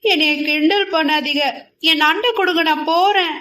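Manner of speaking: tearful protest. Acted. Girl begs the boy to stop teasing, tearful. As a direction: protest through tears. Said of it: "இரண்டு சொட்டு கண்ணீர் சிந்தினாள்".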